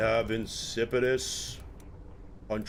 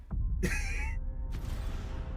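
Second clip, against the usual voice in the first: laugh; 9 dB quieter than usual. Real, not acted. Laughs after dying to bug.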